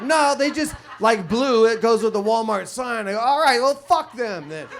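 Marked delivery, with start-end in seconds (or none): 0.0-3.1 mock loud answer. 3.2-4.7 exasperated outburst. Retold or imitated. Exasperated reply.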